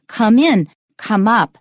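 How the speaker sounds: "in" and "up" stressed at the phrase end. Shown as a demonstration. Teacher demonstrating tonic stress on the particle, "in", "up". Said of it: "所以会特别高".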